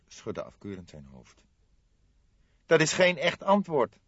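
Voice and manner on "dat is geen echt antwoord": reproachful. Acted. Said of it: "sprak hij bestraffend".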